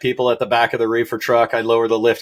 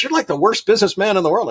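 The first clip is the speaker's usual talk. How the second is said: much higher-pitched mock disbelief; incredulous customer; 9 semitones higher than usual. Customer telling the rancher he is hopeless at business, incredulous. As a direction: imitate an astonished customer passing judgement.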